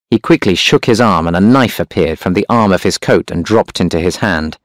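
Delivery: usual speaking voice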